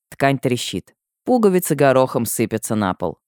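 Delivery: usual speaking voice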